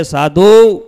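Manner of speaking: loud, stretched hail; calling out. Acted. Hanuman summoning the monks to come near, loud call. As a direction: call them over from a distance.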